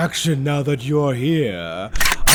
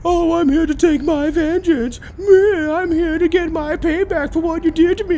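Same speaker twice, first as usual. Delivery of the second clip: much higher, crying mock voice of the vengeful hero; mocking whiny hero; 3 dB louder than usual. Villain mocking the intruder's vengeance speech, high whiny parody. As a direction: parody the avenger as a whimpering crybaby. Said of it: "yes, I've heard this one before".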